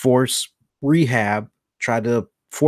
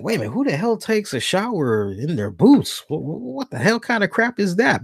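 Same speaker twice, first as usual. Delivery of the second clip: incredulous; 8 semitones higher than usual. Retold or imitated. Imagined incredulous reaction.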